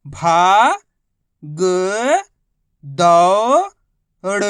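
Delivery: each letter held long and loud, a pause after each; syllable by syllable. Shown as a demonstration. Teacher showing learners each letter's sound, syllable by syllable. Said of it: "एक अक्षर का उच्चारण किया जाता है".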